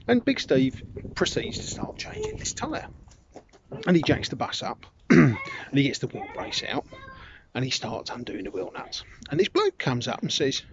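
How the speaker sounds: usual speaking voice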